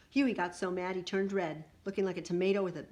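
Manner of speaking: ordinary delivery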